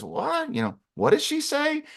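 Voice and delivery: very high puzzled little voice; baffled squeak. Acted. Shoulder bug didn't hear the receptionist, high baffled squeak. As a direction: pipe up high, not having caught it.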